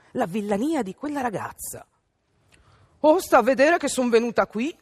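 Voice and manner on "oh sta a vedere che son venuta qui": arrogant rudeness. A narrator's reading. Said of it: "quella sgarbataccia piena di boria".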